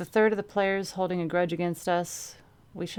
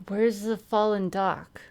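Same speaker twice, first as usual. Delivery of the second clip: slow, slurred, mumbled words; mushy croak; 3 semitones higher, slower than usual. Acted. Dazed Quadra asking her team, in a slurred croak. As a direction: mush the words like a concussed head. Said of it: "My words were a mush of croaking syllables".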